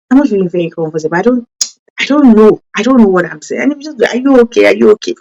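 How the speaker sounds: normal talking voice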